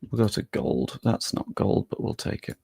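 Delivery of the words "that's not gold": normal talking voice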